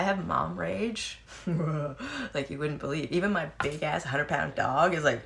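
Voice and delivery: talking through laughter; laughing. Real, not acted. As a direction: chuckle through the words. Laughing while telling story.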